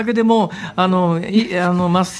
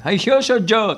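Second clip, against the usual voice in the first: put-on higher, foreign-accented ceremonial announcement; foreign award presenter; 5 semitones higher, slower than usual. Pan Am man at sumo award ceremony, imitated foreign-accented announcement. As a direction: imitate a foreigner proudly reading out Japanese. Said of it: "名物外人のおじさんがやっていて".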